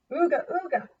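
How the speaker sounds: high caveman call. Acted. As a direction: call the dinosaur home. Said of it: "called the grunts".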